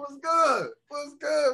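high, excited hello; excited greeting. Real, not acted. Greeting old friend excitedly.

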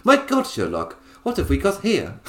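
a hint of a plummy Victorian Brit, amused; Enola Holmes voice. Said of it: "That's why that voice is in my head".

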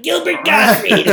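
high, loud, drawn-out impression; Gilbert Gottfried. Likening it to the parrot in Aladdin, high loud squawk. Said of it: "it's kinda like".

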